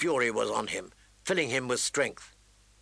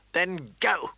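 spitting; 4 semitones higher than usual. Acted. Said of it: "he spit at her".

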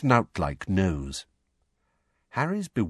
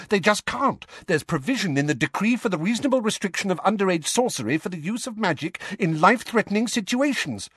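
excited gush; 10 semitones higher than usual. A narrator's reading. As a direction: gush over him, thrilled. Hermione's excited outburst.

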